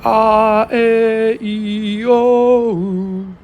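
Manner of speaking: high, held vowels gliding into one another; sustained vowel tones. Shown as a demonstration. Recording sample tones for voice cloning, high held vowels. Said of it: "in all different type of tones".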